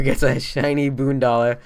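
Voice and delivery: normal talking voice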